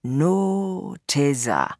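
very slow, stretched-out words; drawn-out. Acted. Tunde turning in a slow circle, drawn-out. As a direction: draw each word right out.

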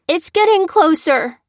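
high, loud panicked cry; alarmed boy crying out a warning; frightened cry. A narrator's reading. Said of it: "cried Jack".

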